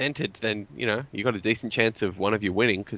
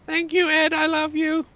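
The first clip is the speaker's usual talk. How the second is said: gushing put-on falsetto; Ilrad; slower than usual. Pretend winner thanking the host and sponsor, silly voice. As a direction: play a thrilled winner in a squeaky voice. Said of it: "Stop doing that voice".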